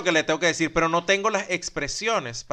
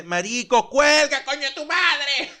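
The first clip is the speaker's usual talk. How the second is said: retold yell; 9 semitones higher, much slower than usual. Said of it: "Y dice".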